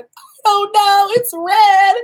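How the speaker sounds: high, tearful whine; mock sobbing about a stubbed pinky; whiny crying. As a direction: whimper it like a hurt kid. Retelling her pinky injury.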